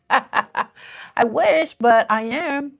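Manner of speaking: real laugh while answering a compliment. Real, not acted. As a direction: laugh through the words, flattered. Chat says she isn't fifty.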